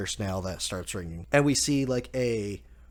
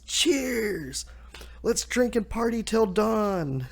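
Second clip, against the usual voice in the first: much higher, giddy toast; party cheer; 10 semitones higher, slower than usual. Retold or imitated. Luffy toasting everyone at the festival, giddy cheer. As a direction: raise a glass and whoop it up.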